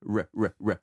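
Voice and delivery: low, repeated "R" from deep in the mouth; "R" made at the back of the mouth. Shown as a demonstration. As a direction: isolate the English "R" sound, low. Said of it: "du fond de la bouche".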